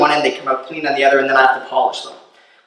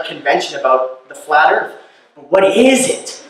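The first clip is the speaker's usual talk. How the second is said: ordinary talk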